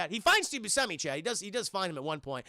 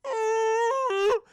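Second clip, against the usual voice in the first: long, high, loud mock moan; hammed-up distant cry; imitated wail; 14 semitones higher, 7 dB louder than usual. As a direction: hold a sustained ghostly "ooh". Imitates moan heard in cornfield.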